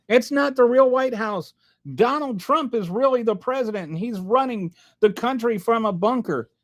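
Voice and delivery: lightly mock their claims; mocking conspiracy believer. Mocking maga viewers, a light sarcastic parody. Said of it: "The way you guys coped".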